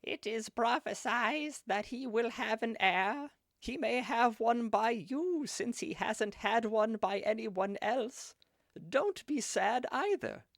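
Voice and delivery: put-on high, slow old hag; drawn-out, high-pitched hag prophecy. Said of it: "little bit of a hag voice".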